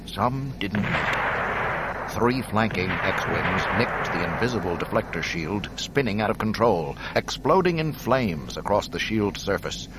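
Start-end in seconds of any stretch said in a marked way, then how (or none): none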